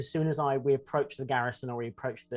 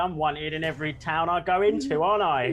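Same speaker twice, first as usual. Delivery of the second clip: louder, rough attempt at a character impression; Ripper; 4 semitones higher, 7 dB louder than usual. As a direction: play a cocky wanted regular, loud. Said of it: "that's not my good impression".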